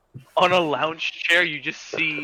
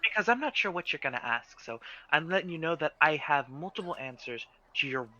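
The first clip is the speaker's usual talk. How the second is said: eager shopkeeper; 2 semitones higher than usual. Acted. Nora answers Sirocco.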